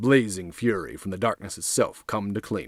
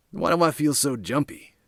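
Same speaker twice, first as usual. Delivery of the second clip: higher-pitched nervous thug; on edge, voice raised in pitch; jumpy; 7 semitones higher than usual. Acted. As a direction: ask it uneasily, pitched up. Nervous thug questioning his own nerves.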